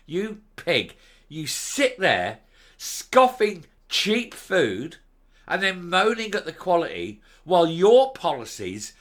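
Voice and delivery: loud, outraged insults at a politician; furious shouted tirade; furious rant. Real, not acted. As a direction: spit the insults out in disgust. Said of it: "I'm really angry".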